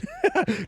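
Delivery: a short high laugh at the joke; sudden high laugh; bright burst of laughter. Real, not acted. Laughs at the message.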